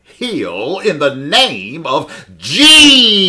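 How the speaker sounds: hammed-up faith healer cry, very loud and high, drawn out. Mocking TV faith healers to the congregation, hammed-up healing cry. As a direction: slowly bellow it, stretching the holy name into a wail. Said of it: "these faith healers on T V".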